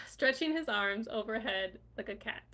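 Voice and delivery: ordinary talk, nothing marked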